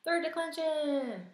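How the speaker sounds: high, loud, slow student calling out; student blurting out the answer; eager student answer. Retold or imitated. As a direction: shout the answer like a keen pupil. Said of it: "Hopefully somebody said".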